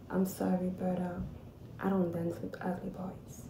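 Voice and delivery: whispered cold payback line; icy revenge whisper. Retold or imitated. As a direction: hush it out with sweet revenge. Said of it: "I turned around to him and I was like".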